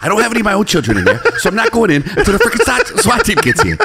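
high, laughing excuse-maker impression; Mark Wahlberg. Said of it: "Do you think Mark Wahlberg's".

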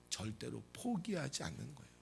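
ordinary talk, nothing marked

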